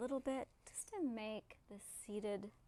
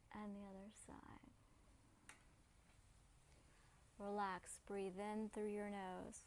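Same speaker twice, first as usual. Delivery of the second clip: calm, soothing voice with long pauses; unhurried, low-key relaxation cue; guided meditation; 3 semitones lower, 8 dB quieter than usual. Real, not acted. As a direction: gently guide them through breath and movement.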